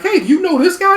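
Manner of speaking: imitating a chatty tourist lady; mock lady voice. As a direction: jump way up into a lady's voice. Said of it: "one lady's like".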